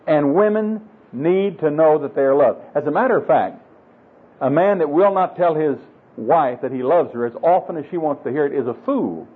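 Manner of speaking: normal talking voice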